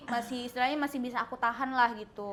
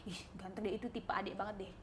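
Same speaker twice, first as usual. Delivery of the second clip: soft, lower-pitched imitated aside; quiet aside; 6 semitones lower, 14 dB quieter than usual. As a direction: quietly point someone out to a partner. Retold aside about passing guy. (bisikan kecil menirukan obrolan)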